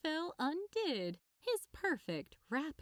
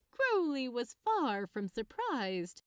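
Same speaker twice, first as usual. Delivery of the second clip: ordinary delivery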